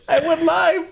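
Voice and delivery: high-pitched, cracking up on the words; squeaky with suppressed laughter; laughing confession. Retold or imitated. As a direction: retell it through laughter. Laughing while retelling his confession.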